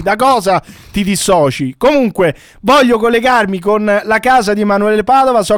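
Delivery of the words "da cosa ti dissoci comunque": ordinary delivery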